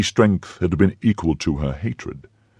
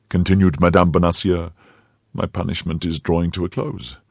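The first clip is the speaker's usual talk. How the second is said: ordinary delivery, nothing marked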